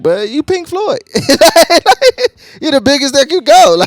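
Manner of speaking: high, loud incredulous mock; incredulous mock with laughter. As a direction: scoff at the superstars. Mocking the big artists.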